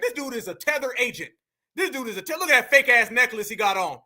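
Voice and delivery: normal talking voice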